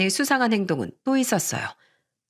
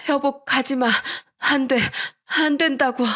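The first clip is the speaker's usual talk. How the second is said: pleading in a nightmare; 5 semitones higher than usual. Acted. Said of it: "악몽이라도 꾸는지 식은땀을 흘리면서 중얼거리고".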